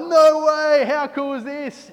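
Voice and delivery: a high, loud "No way" that falls back at the end; amazed disbelief. Retold or imitated. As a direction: gush like starstruck surfers. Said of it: "we're just like".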